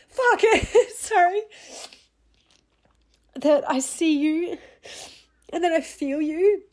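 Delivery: sudden high, tearful burst, then slow; crying through words. Real, not acted. Telling grieving listeners she sees them, tearful breaking voice. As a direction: break down mid-sentence and push on. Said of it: "without being emotional".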